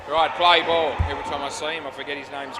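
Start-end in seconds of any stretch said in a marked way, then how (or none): 0.1-0.9 loud, higher call to start play. Real, not acted. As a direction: call it out across the court. Umpire-style call to start play.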